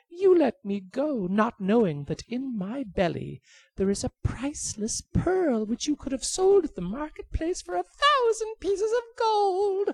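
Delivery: sly bird dangling a false treasure; high teasing bird voice. Acted.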